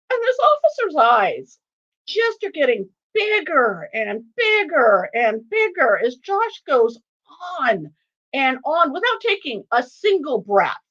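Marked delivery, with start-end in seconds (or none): none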